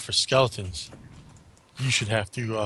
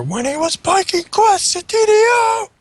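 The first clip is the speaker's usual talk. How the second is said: high, loud hammed-up yell; shouted quote; 6 dB louder than usual. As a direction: belt the quote out like a movie line. Quoting Heavy Metal line.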